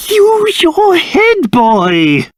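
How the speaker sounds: high, drawn-out reproach; slow, high-pitched telling-off; exasperated scolding. Acted. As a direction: stretch each word out in exasperation. Scolding the boy.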